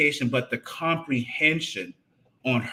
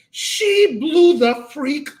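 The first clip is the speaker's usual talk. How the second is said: blew up; 13 semitones higher, 7 dB louder, slower than usual. Retold or imitated. Narrating wife's explosion.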